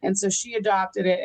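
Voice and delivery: ordinary talk, nothing marked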